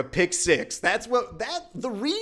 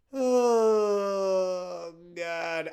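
exasperated fan's drawn-out groan; anguished groan. Real, not acted. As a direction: moan like a fan who can't watch. Despairing over the play call.

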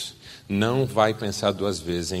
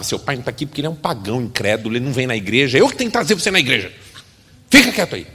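exasperated mother; 5 dB louder, much faster than usual. Acted. Mother scolding son about his father, building to a loud snap. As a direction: lose your patience by the end.

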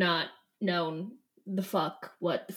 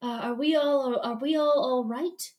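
concerned. Retold or imitated. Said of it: "and he's like".

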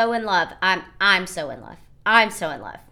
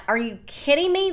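higher-pitched, indignant disbelief; mock-shocked retort, pitched up; retold incredulity; 7 semitones higher than usual. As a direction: replay your incredulous comeback. Retold incredulous reply in quarrel.

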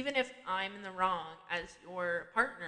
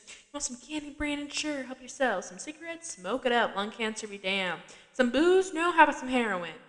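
higher, building to louder sarcastic offers; sarcastic mock hospitality; 4 semitones higher, 6 dB louder than usual. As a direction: play the fed-up stepmom offering everything. Sarcastic stepmom offering vices.

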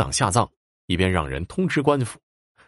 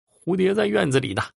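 high, raised call; calling from outside; 11 semitones higher than usual. A narrator's reading. Old woman calling in from the outer room, raised call. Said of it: "只听拐婆在外屋叫着".

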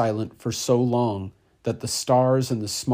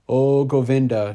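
plain reading voice

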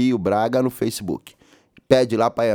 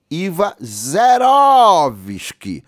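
drawn-out hype call; 7 semitones higher, 5 dB louder, much slower than usual. Real, not acted. Host hyping the zero VAT promo.

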